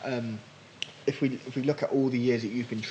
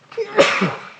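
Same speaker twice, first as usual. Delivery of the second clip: loud sudden sneeze; a real sneeze from hay fever; sneezing; 9 dB louder than usual. Real, not acted. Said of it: "I'm gonna sneeze".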